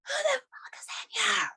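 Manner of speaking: high, strained retold yell; belted out. Retelling a bathroom scare, a high strained yell. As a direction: reenact a startled bellow at the intruder. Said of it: "I just belted out".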